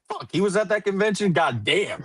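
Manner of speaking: half-joking shocked outburst; mock surprise. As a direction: blurt it out in sudden disbelief. Imagined startled reaction.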